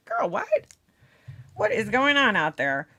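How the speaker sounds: high-pitched disbelief; incredulous. Real, not acted. Streamer reacting to viewer's snow in Alabama, high-pitched disbelief. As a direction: react in real surprise, pitch shooting up.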